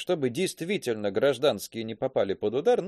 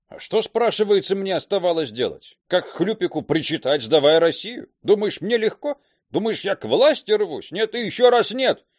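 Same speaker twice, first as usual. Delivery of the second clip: loud, high, indignant protest; heated outburst; 7 semitones higher, 6 dB louder than usual. Acted. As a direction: defend yourself with rising anger. General's heated outburst at Sergei. (возмущённо и громко)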